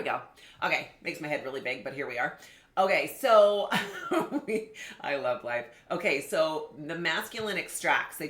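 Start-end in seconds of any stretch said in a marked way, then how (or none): 2.8-3.7 laughing as she restarts; words starting to break into a laugh. Real, not acted. Host laughs at how her head looks on screen, giggling. 3.8-4.4 quick high airy laugh; high breathy giggle. Real, not acted. Host giggles at her own image on camera, breathy laugh. 4.5-5.6 speaking through leftover laughter; laughing. Real, not acted. Host recovers from a giggle, laughing words.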